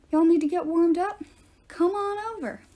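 put-on a toothless old drifter waving the kids over; folksy homeless man. A narrator's reading. Old homeless man calling strangers to his fire, friendly and high.

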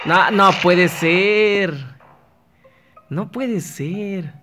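dragged-out cry of disbelief at being caught; dismayed disbelief. Real, not acted. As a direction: stretch each word out in shock. Gets killed in game.

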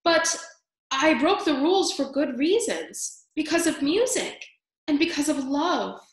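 little mouse raising his voice to the judge. A narrator's reading. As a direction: push your voice up to defend yourself. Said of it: "He raised his voice".

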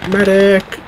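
high call across the field; man down shout. Real, not acted. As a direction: shout for the medic. Calling medic again.

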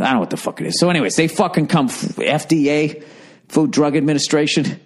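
usual speaking voice

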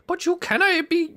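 put-on very high, failed Irish accent; botched Irish accent. Player gamely trying Scrooge's voice, badly faked Irish accent. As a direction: squeak out a bad attempt at Irish. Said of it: "I can't do an Irish accent".

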